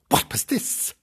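breathy whisper; mysterious whisper; whispered wonder. Acted.

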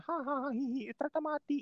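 high, soft jeering giggle before the punchline; mocking cackle. Netizens gloating over a stranger's death, high mocking giggle. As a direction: snicker like a heartless troll.

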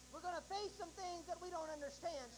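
ordinary talk, nothing marked

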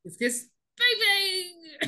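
high, slow, drawn-out playful delivery; theatrical title call. Pretend. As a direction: stretch the title out high and silly. Playfully performing the episode title.

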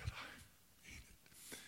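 choked, barely voiced tearful whisper; imitated sobbing. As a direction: act out a boy too tearful to speak up. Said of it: "the boy was sobbing".

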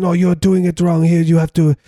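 high, loud mock French scolding; French accent. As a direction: correct someone in a hammed-up French accent. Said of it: "is my French accent".

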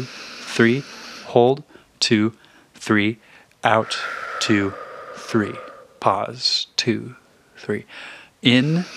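slow paced breathing count. Shown as a demonstration. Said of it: "we're going to breathe in through our nose".

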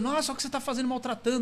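fast scandalized imitation of critics; indignant quote. Imitating people scolding the prank, mock outrage.

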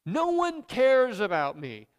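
loud, slow self-pitying wail; whiny complaint. Acted. Pastor voices a sulking believer, loud and slow. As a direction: moan it out to God, slow and loud.

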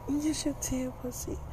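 put-on high, soft girlfriend voice; Tia's voice. Tia answering David in bed, high and soft.

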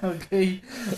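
high giggly okay; laughing. Real, not acted. Laughing fit.

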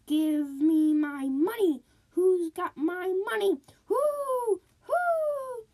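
high, loud, drawn-out ghostly wail. A narrator's reading. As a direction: moan it out like a dead woman searching for her money. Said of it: "a voice cried".

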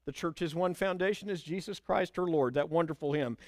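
usual speaking voice

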